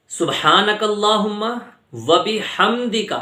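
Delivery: slow, drawn-out Arabic recitation; careful recitation. Shown as a demonstration. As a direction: recite سبحانک اللہم syllable by syllable. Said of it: "سبحانک اللہم میں ہم ایک اور غلطی".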